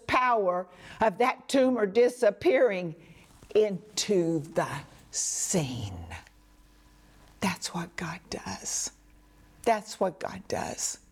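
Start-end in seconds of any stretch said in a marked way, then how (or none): 3.5-5.9 fading to a whisper. Real, not acted. Preacher drops to a hush. 7.4-8.9 soft unvoiced whisper, much quieter. Real, not acted. Repeated line, first take whispered.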